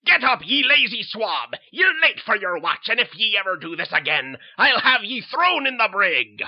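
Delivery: loud, harsh pirate-captain roar; bellowing captain. Acted. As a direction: bark orders like a tyrant at sea. Captain bellows orders at night.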